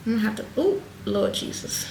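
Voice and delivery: breathy whispered aside. Real, not acted. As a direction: mutter it under your breath. Whispered exasperation over baby hairs.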